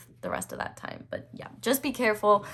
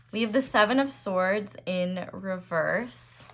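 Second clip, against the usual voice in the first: ordinary talk, nothing marked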